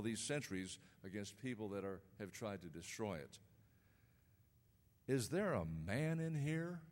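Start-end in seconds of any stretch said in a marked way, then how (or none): 5.1-6.8 rising and falling preacher's recital, dropping to a hush at the end; dramatic poem recitation. Acted. Guest speaker reciting his poem to the congregation, dramatic recital. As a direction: deliver it like a spoken hymn.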